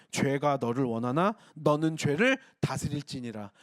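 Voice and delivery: low, slow verse reading; scripture reading. Acted. Pastor quoting verse seven to the congregation, solemn scripture reading. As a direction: quote the verse deliberately, pitch dropped.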